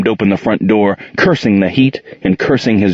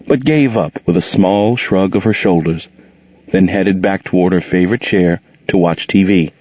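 plain reading voice

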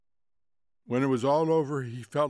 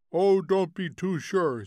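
higher, louder contradiction; quarrelsome retort; 6 semitones higher, 5 dB louder than usual. Acted. Cousin arguing with the aunt, raised quarrelsome voice.

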